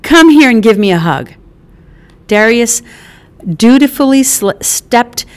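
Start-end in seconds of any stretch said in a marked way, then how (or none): 0.0-1.3 high, loud delighted mother scolding then hugging; a brash mother jolted awake at her door, then all arms; Mickey, startled mom. Acted. Mother surprised by her son's visit, loud and delighted.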